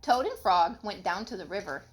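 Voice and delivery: usual speaking voice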